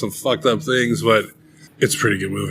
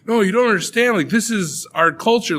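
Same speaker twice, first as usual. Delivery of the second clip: mock earnest protest; 10 semitones higher than usual. Acts villagers protesting to outsiders.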